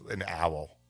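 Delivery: normal talking voice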